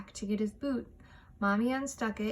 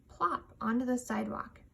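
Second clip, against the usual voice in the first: plain reading voice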